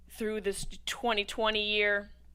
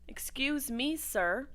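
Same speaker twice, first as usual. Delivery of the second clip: ordinary delivery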